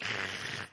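loud, noisy shop-vac suction sound; imitated vacuum noise. Mimics a vacuum while gorging food.

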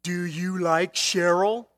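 mock schoolkid quizzing a classmate; grade-school teasing. One grade-schooler asking another about a crush, teasing. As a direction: put on a prying grade-school question, drawn out. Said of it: "Remember in grade school?".

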